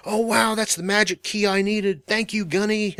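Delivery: high, soft gushing as an imagined fan; mock awed epiphany. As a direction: parody a grateful, wonderstruck student. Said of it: "get great epiphanies from and go".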